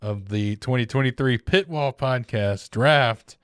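slow, grand draft announcement; announcer voice. Acted. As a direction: announce the pick like a ceremony. Formal draft pick announcement.

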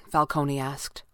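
ordinary delivery, nothing marked

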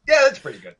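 high-pitched imitation of approving listeners; clients' verdict. Clients judging his American accent, high-pitched mock approval. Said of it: "their response was".

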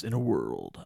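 slow booming trailer narrator; movie trailer voice, slowed down. Shown as a demonstration. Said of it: "I've got movie theater voice".

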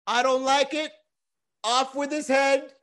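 high-pitched mock royal verdict; haughty queen. Queen rejects the meme shown to her, haughty. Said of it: "she sits on a throne and she's like".

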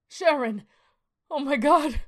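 high, loud cry of shock; horrified cry. Acted. Alan finds his wife's body, horrified cry. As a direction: cry out in horror.

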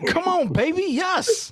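high, excited cheer. Retold or imitated.

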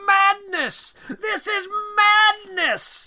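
very high, drawn-out wailing shout; overdoing the doctor's final cry; hammed-up anguished shout. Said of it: "just going".

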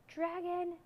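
high calling shout; shouted challenge. A narrator's reading. Boy calls the dragon out, high shout. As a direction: call out boldly at a high pitch. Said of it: "shouted the boy".

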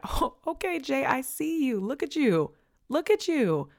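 put-on high, gushing hype-girl cheer; hyped-up friends. Friends hyping her up at dinner, high gushing cheer. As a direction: cheer her on like your best girlfriends. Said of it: "I want your girls to be like".

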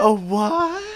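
imitating "A what?". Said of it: "He says it like that?".

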